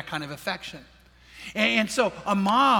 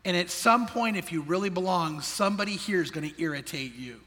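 ordinary talk, nothing marked

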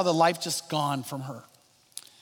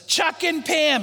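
mock friendly yell; 9 semitones higher, slower than usual. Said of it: "he yells it out real loud".

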